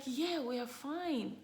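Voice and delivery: slow, soft-spoken announcement of twins; hushed news. Retold or imitated. Telling husband on the phone, slow and hushed. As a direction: let it sink in gently, slowly. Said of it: "It's like".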